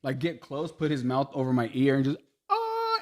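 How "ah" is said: mock scream. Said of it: "and just scream and shit".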